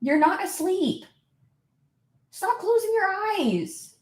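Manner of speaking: high, drawn-out pet scolding; exasperated high singsong reproach; playful scolding. Real, not acted. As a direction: whine it slowly at a naughty dog. Scolding dog feigning sleep.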